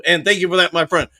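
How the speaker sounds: ordinary talk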